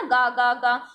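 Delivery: "गा गा गा" in separate, even beats; stuttered repetition. Shown as a demonstration. Teacher recaps stuttering for students, repeated "गा गा गा". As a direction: repeat the sound "गा गा गा" as if stuck. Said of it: "किसी ध्वनि को बार-बार दोहराते हैं".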